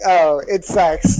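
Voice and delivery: glum mocking. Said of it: "The way you read fun way was like".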